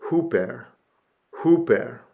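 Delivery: "huper" said as HOO-pair; careful pronunciation. Shown as a demonstration. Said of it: "This preposition means".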